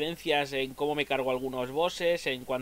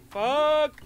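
frustrated curse; 10 semitones higher, 6 dB louder than usual. Real, not acted. Hit by the boss again.

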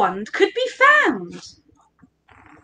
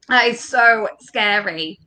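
usual speaking voice

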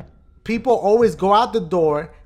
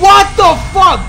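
loud, high, drawn-out yell of shock; startled shout; 10 semitones higher, 10 dB louder, slower than usual. Real, not acted. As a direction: stretch it out in a frightened yell. Jump scare in the game.

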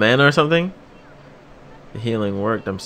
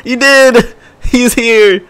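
much higher, louder cheer; hyped; 10 semitones higher, 4 dB louder, slower than usual. Real, not acted. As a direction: shout it like your favorite just showed up. Hype as character arrives.

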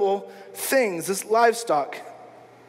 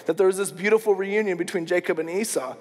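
usual speaking voice

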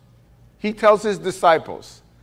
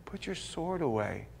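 gentle Lord; 4 semitones lower, 16 dB quieter than usual. Retold or imitated.

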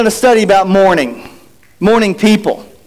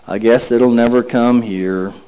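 mock mope; 11 semitones lower, 4 dB quieter than usual. Moping about no fall weather.

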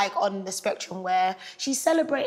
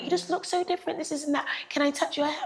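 mock coworker; 7 semitones higher, faster than usual.

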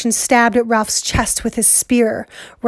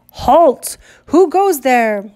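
shouted challenge; 3 semitones higher, slower than usual. Acted. Sentry challenge.

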